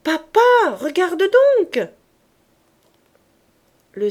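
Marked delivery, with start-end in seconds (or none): 0.0-1.9 loud, high, slow cry; a little girl shouting up to her father; child shouting. Acted. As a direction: call up to papa with all her might. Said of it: "criait-elle de toute sa force".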